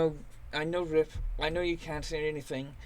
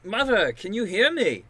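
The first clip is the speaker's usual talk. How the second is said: higher, louder call to his mother; 5 semitones higher, 5 dB louder than usual. Acted. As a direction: call into the dark for her. Calling to the unseen voice.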